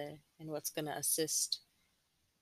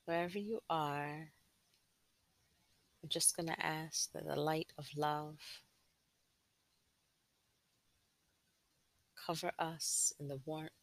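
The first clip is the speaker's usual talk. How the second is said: guided meditation. Acted. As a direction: gently guide them inward, unhurried.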